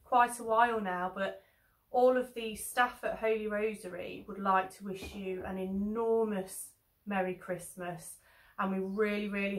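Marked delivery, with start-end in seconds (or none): none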